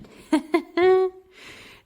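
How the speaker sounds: laugh. Real, not acted. Laughs after the whispered aside.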